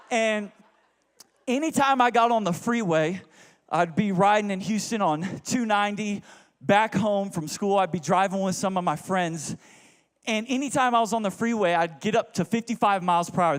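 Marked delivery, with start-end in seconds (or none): none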